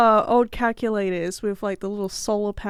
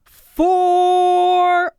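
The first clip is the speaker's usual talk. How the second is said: long, high, loud holler; one drawn-out, sustained yell; golfer's warning shout; 8 semitones higher, 8 dB louder than usual. Shown as a demonstration. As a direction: call it out across a golf course. Calls out golfers' warning cry.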